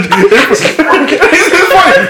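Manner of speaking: laughing hard; words squeezed out between laughs. Real, not acted. Laughing at idea.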